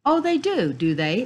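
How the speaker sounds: high, loud start, dropping on the tag question; icy woman's mock surprise. A narrator's reading. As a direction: swoop up high, then come down coldly. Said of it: "the woman said icily".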